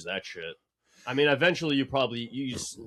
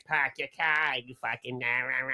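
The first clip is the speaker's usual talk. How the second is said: mock Boston accent; 2 semitones higher than usual. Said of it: "everyone wants us to be like".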